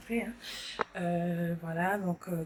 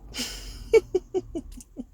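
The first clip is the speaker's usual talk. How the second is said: short high airy laugh; a quick laugh under your breath; breathy laugh; 13 semitones higher than usual. Real, not acted. Laughs after repeating the phrase.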